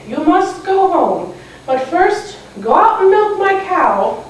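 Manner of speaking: put-on high, slow old lady voice; little old lady. Old lady sending a girl home, high, slow voice. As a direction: gently order it as an old lady. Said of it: "the little old lady said".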